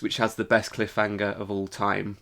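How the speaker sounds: usual speaking voice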